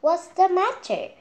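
high, loud worried hen voice; hen asking. Acted. Henny Penny asking the chick, high and worried. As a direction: ask the panicking chick what's wrong. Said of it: "asked Hen Penny".

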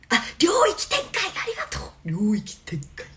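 high, loud anime-style cry; playful anime battle call; domain expansion pose. Pretend. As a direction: shout it like casting a special move. Fans send domain expansion, she acts it.

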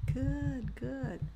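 slow, soft teacher praise. Acted. Teacher praising students after each repeat, soft and slow. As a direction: reassure the learner gently.

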